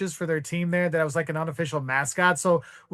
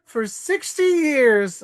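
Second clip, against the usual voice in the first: put-on high, slow voice of an old man; slow proud old-timer voice; old couple; 10 semitones higher, much slower than usual. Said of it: "And they're like".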